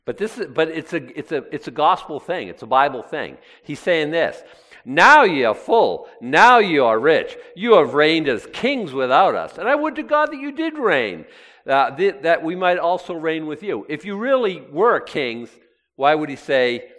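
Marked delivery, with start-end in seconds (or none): 4.8-13.9 divine sarcasm. Acted. Preacher voicing Paul mocking the boastful believers, sarcastic. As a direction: lay the sarcasm on thick and emphatic. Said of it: "you see the sarcasm?".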